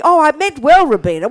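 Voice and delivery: hammed-up apologetic Shuki; mocking Shuki. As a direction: mimic a sheepish do-gooder. Imitates Shuki's excuse.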